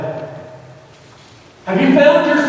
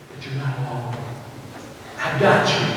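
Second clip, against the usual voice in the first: much softer, breathy whispered reassurance; tender whisper; 10 semitones lower, 9 dB quieter than usual. Acted. God whispering comfort to a hurting believer, tender whisper.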